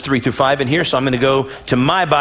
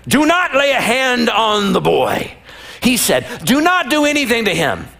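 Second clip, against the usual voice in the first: lightly voiced angel; 7 semitones higher than usual. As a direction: call down from heaven. Angel calling from heaven.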